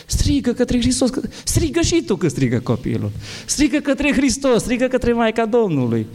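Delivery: high, urgent strigă, shaking him awake; fervent exhortation. Retold or imitated. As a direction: cry it out to him with force. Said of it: "l-am scuturat bine pe acest tânăr și am zis".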